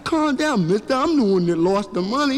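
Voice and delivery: high-pitched acted little boy; mock-indignant kid voice; protesting kid. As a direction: push back like an upset child.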